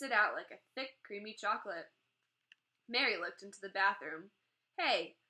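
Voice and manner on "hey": sharp, high little-sister demand; a girl catching her brother in the act, demanding. A narrator's reading. Girl catches brother eating toothpaste, sharp high demand. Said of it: "she demanded".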